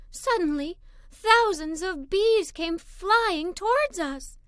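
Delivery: usual speaking voice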